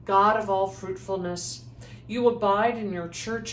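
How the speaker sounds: ordinary talk, nothing marked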